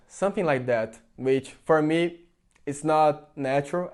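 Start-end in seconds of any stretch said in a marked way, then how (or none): none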